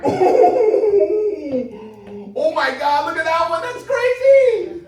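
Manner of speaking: high, loud squeal of amazement; amazed excitement. Acted. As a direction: gush in hammed-up awe, stretching the last words. Acting out amazement at the slideshow.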